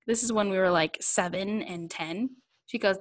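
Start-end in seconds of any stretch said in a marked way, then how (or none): none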